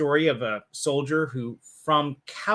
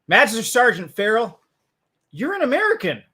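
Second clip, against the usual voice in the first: quoting Cage; 8 semitones higher, 7 dB louder than usual. Retold or imitated. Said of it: "he is".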